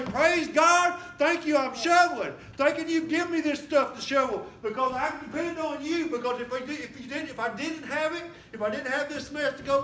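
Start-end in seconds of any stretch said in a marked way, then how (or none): 0.0-6.1 loud, high shout of praise. Pretend. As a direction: shout your thanks to God. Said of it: "you gotta say".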